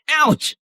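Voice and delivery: ouch. Acted. Hit heads swapping seats.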